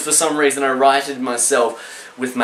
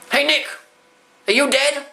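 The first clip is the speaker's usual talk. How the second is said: higher calling voice; calling dad; 10 semitones higher than usual. Retold or imitated. Dad calling down to his son, raised call. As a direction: call down the cliff. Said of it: "he's like".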